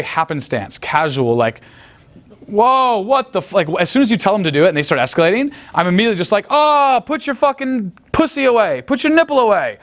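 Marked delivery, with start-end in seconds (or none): 2.5-3.5 mock shock. Acted shocked reaction in example. 6.5-9.8 high, teasing mock outrage; playful fake scolding at the girls. Acted teasing line to girls.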